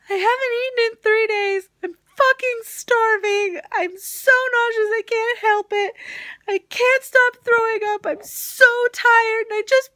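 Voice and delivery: loud, high-pitched hammed-up wailing; re-enacted bawling, very high and loud; mock sobbing. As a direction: wail it like an exaggerated crying meltdown. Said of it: "And I mean, just sobbing".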